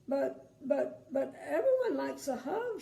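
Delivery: high, upset cry with a sob. Acted. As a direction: plead tearfully, voice rising. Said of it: "she cried".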